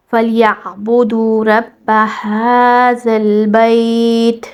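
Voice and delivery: slow, drawn-out Quranic recitation; tajweed recitation. Shown as a demonstration. Teacher modelling the verse for students, slow tajweed recitation. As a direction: chant each letter with full length. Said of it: "مکمل تجوید کے ساتھ".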